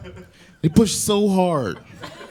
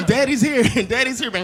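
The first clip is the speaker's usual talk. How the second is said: cooing dad; 9 semitones higher than usual. Retold or imitated.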